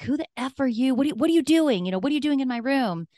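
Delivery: high-pitched indignant demand; mock-yell at an intruder in rising outrage; retold yell. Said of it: "And so I yelled at him".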